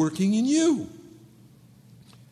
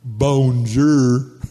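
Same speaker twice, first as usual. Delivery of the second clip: low, put-on French "Bonjour"; 5 semitones lower, 7 dB louder than usual. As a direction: greet her like a suave French model. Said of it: "and he goes".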